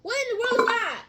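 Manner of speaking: loud, high-pitched shocked yell; startled shout. Real, not acted. Reacting to dad's microwave mishap, startled shout. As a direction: shout it in disbelief.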